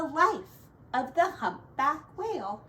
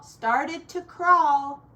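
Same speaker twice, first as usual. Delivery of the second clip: plain reading voice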